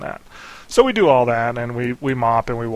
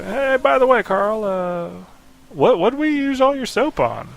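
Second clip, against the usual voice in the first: much higher, louder, sweetly pointed question; mock innocent jab; 10 semitones higher, 3 dB louder, slower than usual. Teasing brother about the soap, high fake innocence. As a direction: play dumb to catch your brother out.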